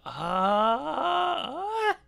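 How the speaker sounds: high rising puzzled cry; ah turned into a question. Shown as a demonstration. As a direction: yell it like a question. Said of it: "add a question mark to the end".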